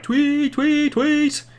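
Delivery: high, repeated mock whistle blasts; Bill Alfonso whistle imitation. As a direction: tweet like a whistle blown nonstop. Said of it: "Bill Alfonso constantly doing".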